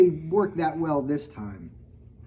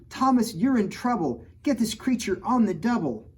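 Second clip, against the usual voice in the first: higher, louder scolding call; hollering mom; 7 semitones higher, 4 dB louder than usual. A narrator's reading. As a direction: holler it across the house. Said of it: "Mom hollered".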